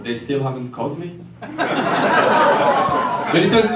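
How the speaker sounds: ordinary talk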